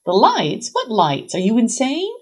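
punchy, disbelieving questions; incredulous mother. A narrator's reading. Mother hears her daughter's reason, incredulous. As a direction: throw it back in sheer disbelief.

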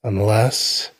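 ordinary delivery

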